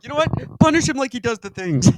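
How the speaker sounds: high, loud mock-mob demand; hammed-up crowd turning on him; accusing mob. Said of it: "They're like".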